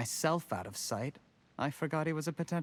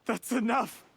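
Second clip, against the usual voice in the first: angry outburst; 9 semitones higher than usual. Acted. Cutting off Yelan's accusations.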